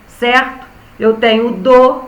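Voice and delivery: usual speaking voice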